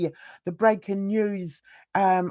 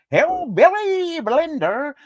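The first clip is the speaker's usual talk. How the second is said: higher, exaggerated Southern twang on the name; mock hillbilly accent; 5 semitones higher, 4 dB louder than usual.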